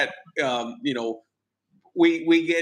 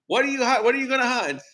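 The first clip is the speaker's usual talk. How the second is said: mock father voice; 9 semitones higher, faster than usual. Voicing the father.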